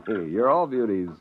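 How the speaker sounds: ordinary delivery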